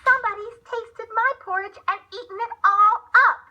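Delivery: put-on shrill baby bear wail; piping little bear. A narrator's reading. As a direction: pipe it up like a tiny upset cub. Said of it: "the little bear piped".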